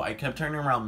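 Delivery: ordinary delivery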